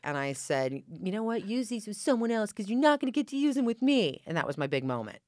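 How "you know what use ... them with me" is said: rising high and loud to a sneering finish; triumphant put-down. Retold or imitated. Girl throwing condoms at her cheating boyfriend, scornful and loud. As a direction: toss the line at him like a final blow.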